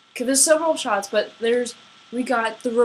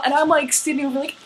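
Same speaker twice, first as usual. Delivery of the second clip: usual speaking voice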